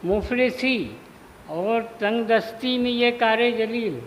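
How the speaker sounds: measured mushaira delivery, phrase by phrase; Urdu poetry recitation. Acted. Poet honouring Turfa before the gathering, measured recitation. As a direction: declaim each half-line and let it hang.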